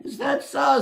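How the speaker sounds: high mocking challenge; cocky Herschel. Herschel mocks the little goblin, high cocky taunt. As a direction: tease him, raise the voice high.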